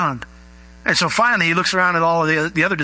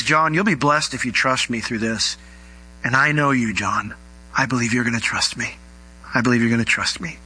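lower, gently confident encouragement; gentle reassurance; 4 semitones lower than usual. Acted. Jesus to doubting John, gentle reassurance. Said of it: "Jesus doesn't browbeat John".

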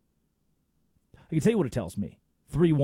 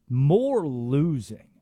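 slow, louder deadpan punchline; dry punchline; 4 dB louder, much slower than usual. Real, not acted. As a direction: drop it slowly like a verdict. Punchline answer to rhetorical question.

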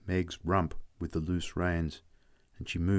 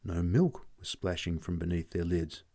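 plain reading voice

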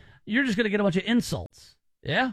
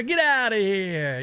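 put-on slow, lightly done Italian accent; a hint of a New York Italian accent; slower than usual. Host tossing out a quick Italian accent, slowed. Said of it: "the good old Italian".

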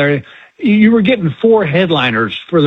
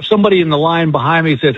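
ordinary delivery

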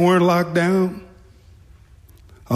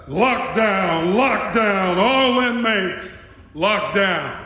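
drawn-out prison announcer call, pitched up; loudspeaker announcement; 4 semitones higher, slower than usual. Retold or imitated. New guard reads the script to all inmates, announcing. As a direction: imitate calling out over the loudspeaker. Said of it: "read over the loudspeaker".

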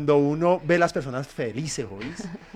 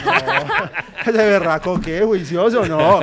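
laughing; 4 semitones higher, 7 dB louder, much slower than usual. Real, not acted. Recuerda al peluquero, riendo.

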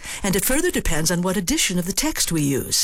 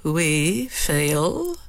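threatening. Shown as a demonstration. Lady Macbeth menacingly questioning her husband.

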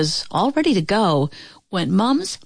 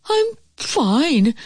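high, mildly shaken reply; wolf; 7 semitones higher than usual. A narrator's reading. Wolf reassures the pigs after falling, mildly rattled high voice. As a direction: answer high after a small fright. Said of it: "said the wolf".

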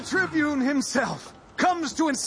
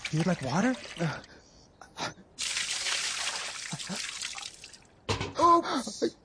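low, taunting sneer; mocking cruelty; 7 semitones lower, 5 dB quieter than usual. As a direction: toy with him cruelly. Guard taunts thirsty prisoner.

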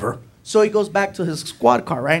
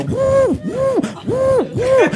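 very high repeated "woo"; frantic blowing hoots; much slower than usual. Retold or imitated. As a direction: hoot out puffs as if clearing smoke. Frantically airing out the car.

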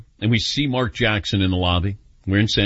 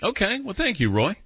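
bemused dismissive thanks; 8 semitones higher, 3 dB quieter than usual. Real, not acted. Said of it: "Was he saying the San Antonio Spurs".

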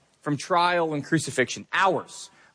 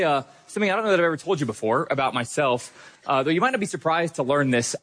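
ordinary talk, nothing marked

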